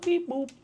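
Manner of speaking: high, chirpy robot beeps; robot beep boop. Pretend. Mimicking a robot noise.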